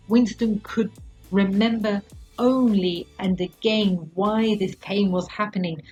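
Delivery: plain reading voice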